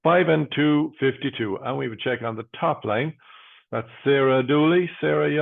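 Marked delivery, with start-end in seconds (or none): 0.0-1.6 steady, rhythmic number calling; bingo caller. Acted. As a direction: call each number out, then pause.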